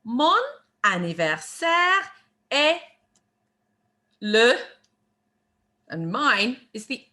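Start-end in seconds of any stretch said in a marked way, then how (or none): 0.0-4.5 French phrase split by clear pauses; deliberate, spaced-out teacher model; word by word. Shown as a demonstration. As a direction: give each word separately for learners to copy. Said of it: "One more time".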